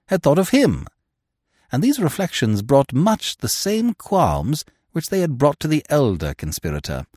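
ordinary delivery

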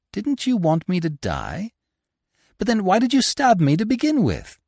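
incredulous. A narrator's reading. Lupin confronting the woman who stabbed him, incredulous.

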